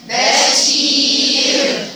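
بشير held long and chanted; drawn-out bashir. Shown as a demonstration. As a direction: stretch بشير slowly and chant it. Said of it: "ازاي تقولوا بشير".

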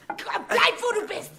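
yelling. Acted. Injured woman yells at him.

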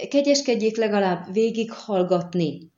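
confused petitioner's appeal; flustered pleading. A narrator's reading. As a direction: beg for a hearing, slightly overacted. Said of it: "és zavarodottsága elfogadható volt".

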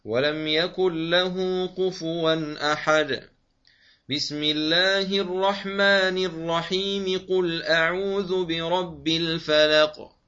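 loud, slow chant, stop after the verse, then basmala flows into the sura; Quran recitation, pause then join. Shown as a demonstration. Teacher demonstrates pausing first, joining second and third. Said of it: "تقطع الأول هكذا".

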